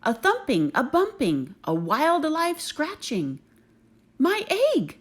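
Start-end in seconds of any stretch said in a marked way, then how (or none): 4.2-5.0 much higher, louder cry of joy; excited shout. Acted. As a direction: shout it as the egg starts to move. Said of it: "shouted Horton".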